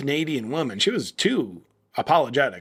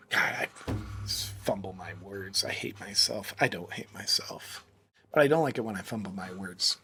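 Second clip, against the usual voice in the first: dropped to a muttered aside, much softer; quiet self-reproach; 6 semitones lower, 9 dB quieter than usual. Real, not acted. Vlogger scolding himself for fumbling, softly muttered. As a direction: grumble at yourself under your breath after tripping on words.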